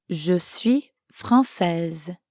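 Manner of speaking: very slow, careful "Je suis française" to repeat; teacher's slow, drawn-out repeat-after-me phrase; slow model phrase. Shown as a demonstration. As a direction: lay out each word slowly for learners to echo. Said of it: "repeat after me".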